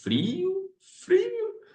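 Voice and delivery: "frio" squeaked up high as if freezing; mock shivering cold. Preacher recalling the freezing dawn, squeaking "frio" high. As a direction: say "frio" like teeth chattering from cold.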